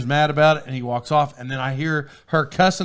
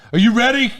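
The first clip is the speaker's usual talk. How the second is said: higher, louder imitation of a waitress; mock yell; 7 semitones higher, 5 dB louder than usual. Waitress barging up to the table, mock yell. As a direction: call it out like an abrupt server, laughing after. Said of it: "she kinda yelled about it".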